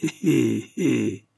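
guarded little exclamation; noncommittal exclamation. A narrator's reading. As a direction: exclaim without giving anything away. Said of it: "s'exclama sans se compromettre".

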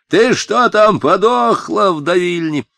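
high, loud holler from a tree; yelling. A narrator's reading. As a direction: yell impatiently across a distance. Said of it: "заорал он с дерева".

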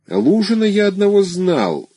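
slow, drawn-out words; slowly. A narrator's reading. The gentleman slowly recalling a Luzhin, slow. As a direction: take your time remembering. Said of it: "медленно произнёс господин".

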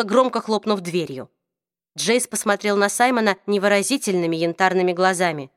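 ordinary delivery, nothing marked

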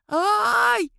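резкий высокий вскрик боли; взвой, получив дубинкой; взвыл от боли. A narrator's reading. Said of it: "взвыл тот". (воет от удара, высоко и громко)

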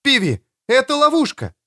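very high, urgent cry; alarmed high-pitched call; cried warning. A narrator's reading. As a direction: cry out to warn of danger. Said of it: "Эстер крикнула".

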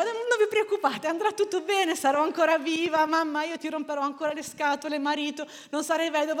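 high, loud mock reassurance; retold pep talk. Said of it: "io li incoraggiavo". (incoraggiamento recitato, acuto e concitato)

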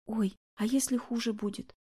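frightened. A narrator's reading. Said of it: "испугалась Наташа".

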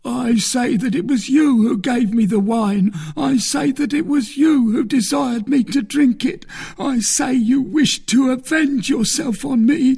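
very high, loud wail breaking into sobbing; agonised accusation. A narrator's reading. Poisoned soldier blaming the man who gave wine, anguished wailing. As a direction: wail the accusation, slowing into tearful gasps.

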